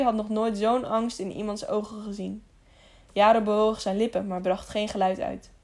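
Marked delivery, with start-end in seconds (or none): none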